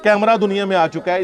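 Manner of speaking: ordinary talk, nothing marked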